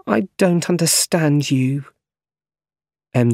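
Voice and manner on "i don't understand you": higher-pitched, gently disappointed; sad. A narrator's reading. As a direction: sad and puzzled, understated. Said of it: "she said sadly".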